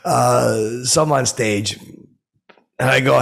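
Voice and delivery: usual speaking voice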